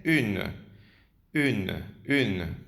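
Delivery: "une" modelled as one syllable, "ün"; separate, careful "une" for learners; correct French pronunciation. Shown as a demonstration. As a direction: repeat "une" with a French u, final e silent. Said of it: "femenino se pronuncia".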